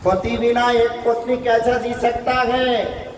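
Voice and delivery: high-pitched imploring question; pleading wife. Acted. Wife asks the Lord how to live without husband, pleading high. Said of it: "रो रो के कहने लगे".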